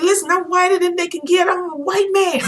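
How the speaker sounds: high, loud excited declaration with laughs; hammed-up worked-up voice; imitating passionate ranter. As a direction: act out his fervent outburst. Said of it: "I could hear the passion in your voice".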